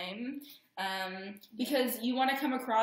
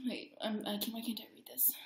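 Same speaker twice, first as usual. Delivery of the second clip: whispered aside; 10 dB quieter than usual. Real, not acted. Muttering while reading notes.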